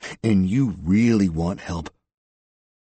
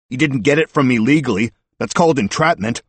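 loud, raised-pitch indignant objection; calling out; 6 semitones higher, 7 dB louder than usual. A narrator's reading. Suspect claiming entrapment to departing detectives, calling out. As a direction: keep calling it across the bar. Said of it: "Eubanks called out".

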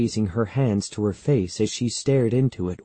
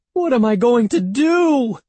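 very high, loud despairing wail; 14 semitones higher, 6 dB louder than usual. A narrator's reading. Evan helpless before Andy, wailing. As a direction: wail it out, stretching the end. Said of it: "Evan wailed".

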